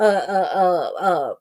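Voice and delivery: usual speaking voice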